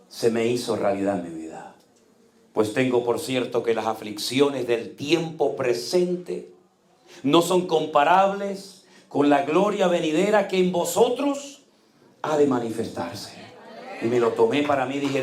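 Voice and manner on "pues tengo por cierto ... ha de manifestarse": slow, solemn verse reading; scripture recital. Acted. A preacher reciting his verse to the church, slow and solemn. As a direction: lift the verse slowly toward vosotros.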